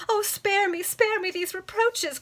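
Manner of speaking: high, loud tearful cry; a hammed-up weeping protest; tearful plea. A narrator's reading. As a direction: plead through tears. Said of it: "cried Isabella, now weeping bitterly".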